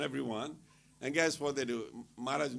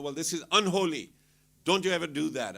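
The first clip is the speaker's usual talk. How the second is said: loud scolding in English; angry scolding; 4 semitones higher, 7 dB louder than usual. Retold or imitated. Scolding the crowd coming at them, loud angry rebuke. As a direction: tell them off hard.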